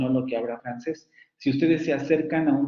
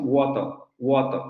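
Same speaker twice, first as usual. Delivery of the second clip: water said British, "woto" with dropped r; British pronunciation. Shown as a demonstration. Teacher showing British water to Spanish-speaking students. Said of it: "lo pronuncian así los británicos".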